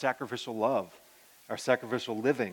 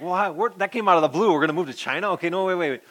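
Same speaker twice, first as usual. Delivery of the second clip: startled protest; 5 semitones higher, 5 dB louder than usual. Retold or imitated. Retells being caught off guard.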